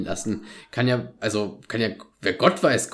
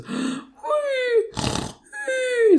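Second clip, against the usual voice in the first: badly faked snore sound; imitating a drunk man's snoring; fake snoring. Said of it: "Geiles Schnarchen".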